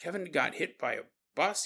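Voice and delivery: halting, stunned question with pauses; trying to process. Retold or imitated. Miles hearing that Kevin got hit by a bus, stunned. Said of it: "he's trying to process all of this".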